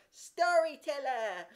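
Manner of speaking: high, loud, slow shriek of storyteller; little girl shriek. A narrator's reading. Little girl shrieking at Jimmy, high loud shriek. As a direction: shriek the accusation. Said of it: "shrieked out".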